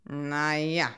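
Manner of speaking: wolf's slightly grumpy mumble; grim murmur. A narrator's reading. Wolf answering the bear about the outing, grimly murmured. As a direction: mutter it with sour doubt. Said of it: "murmelte der Wolf etwas grimmig".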